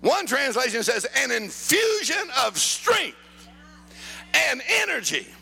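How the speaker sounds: high, punched key words with big pauses; emphatic preaching. Real, not acted. Preacher quotes Paul's prayer for strength to congregation, emphatic preaching. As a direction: proclaim it phrase by phrase, soaring upward.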